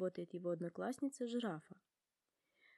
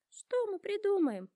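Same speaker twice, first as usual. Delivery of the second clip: sobbing giraffe; 12 semitones higher, 6 dB louder than usual. A narrator's reading. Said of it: "рыдает Жирафа".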